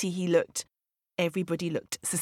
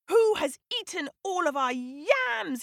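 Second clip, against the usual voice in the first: high-pitched cry of outrage; storybook lion demanding answers, pitched high; outraged cry; 9 semitones higher than usual. A narrator's reading. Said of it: "he cried".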